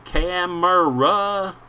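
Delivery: "camera." drawn out very slowly and high, as if to a foreigner; slow exaggerated camera. Photographer explaining to the boatman: slow, over-clear. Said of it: "growing squeakier".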